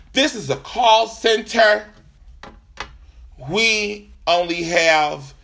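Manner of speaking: slow, spaced-out mock rep; imitated call-centre rep. Rep explaining to customer about managers, slow imitation. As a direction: draw out each phrase as the rep. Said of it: "She says, then to me".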